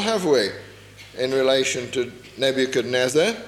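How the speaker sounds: ordinary talk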